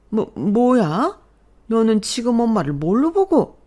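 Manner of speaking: can't believe her son said that; stunned outrage. Acted. Mother stunned by son's words. (어이없어 말 더듬는 시어머니)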